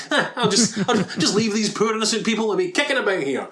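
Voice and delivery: high, quickened sarcastic self-justification; mock nonchalance. Podcaster voicing Brad's flimsy excuse, mock nonchalance.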